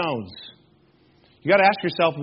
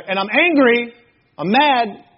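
mock anger; 5 semitones higher, 5 dB louder, slower than usual. Acts out being angry going upstairs.

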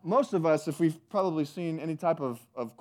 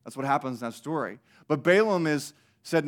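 ordinary talk, nothing marked

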